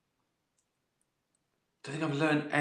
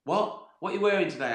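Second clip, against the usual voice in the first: Einstein's wife; 8 semitones higher, 4 dB louder, faster than usual. Pretend. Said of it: "And his wife went".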